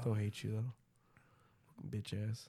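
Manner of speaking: soft whispered jab; mock-spiteful whisper. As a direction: mutter it under the breath. Teasing friend after quote.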